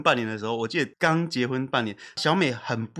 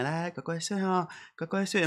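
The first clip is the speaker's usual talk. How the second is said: soothing to sleep; 3 semitones higher, 7 dB quieter than usual. Retold or imitated. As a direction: hush her off to sleep gently. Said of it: "我就会戳一下小美".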